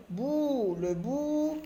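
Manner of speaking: drawn-out teaching pronunciation of "bout"; elongated vowel. Shown as a demonstration. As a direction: hold the "ou" in each "bout". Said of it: "on allonge un petit peu le son".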